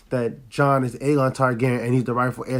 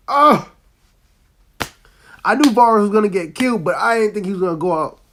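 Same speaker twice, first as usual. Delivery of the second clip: excited outburst; 7 semitones higher than usual. Real, not acted. Reacting to dragon burning Varys.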